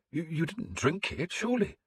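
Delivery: horrified priest; the priest horrified, pitch climbing; aghast. A narrator's reading.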